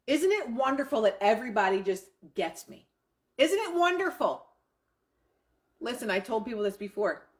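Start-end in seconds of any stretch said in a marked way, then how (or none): 3.4-4.5 bright, high burst of delight; excited affirmation. Shown as a demonstration. Coach demoing a thrilled affirmation, higher and excited. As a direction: sell the joy like it already happened. Joyful affirmation after "I am misunderstood.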